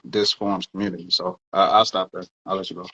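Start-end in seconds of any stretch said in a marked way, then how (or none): none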